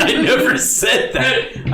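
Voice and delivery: high, slow laughing protest; laughing denial. Real, not acted. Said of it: "repeated denial, laughing".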